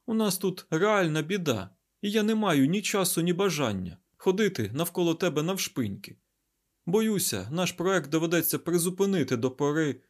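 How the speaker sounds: high-pitched, impatient explaining; Айзек. Acted. Scientist telling the garuda there is trouble, exasperated.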